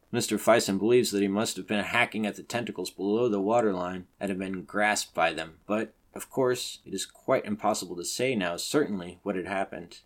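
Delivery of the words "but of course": plain reading voice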